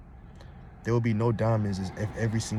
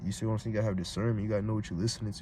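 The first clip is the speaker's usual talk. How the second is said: usual speaking voice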